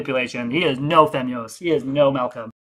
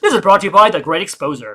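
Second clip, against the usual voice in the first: Great Exposer; 4 dB louder than usual. Retold or imitated. Streamer mimicking a rival's voiceover, mock news-reporter announcing. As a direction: parody a wannabe reporter's sponsor read. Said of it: "Is this how he sounds?".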